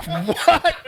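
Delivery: high amazed laughing what; retold disbelief with a laugh; stunned what. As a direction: react like you can't believe it. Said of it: "and I'm there like".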